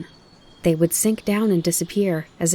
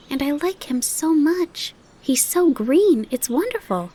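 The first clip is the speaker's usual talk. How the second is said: excited; 8 semitones higher than usual. Acted. Fawn gushes to his mother about the grasshopper, excited. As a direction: gush about the new friend.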